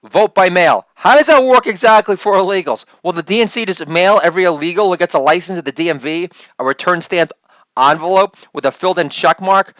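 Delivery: ordinary talk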